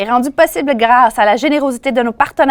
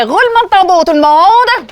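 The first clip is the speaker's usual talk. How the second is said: excited call; 6 semitones higher, 4 dB louder than usual. Real, not acted. Host calling for drum roll.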